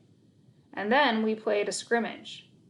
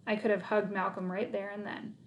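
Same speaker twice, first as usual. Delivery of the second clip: ordinary delivery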